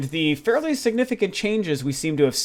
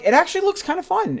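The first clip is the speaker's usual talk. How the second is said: much higher, lightly put-on voice; high-pitched self-quote; 14 semitones higher than usual. Gamer admitting the trailer's appeal, in a raised voice. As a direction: quote your own reaction way up high. Quoting own grudging squinting reaction.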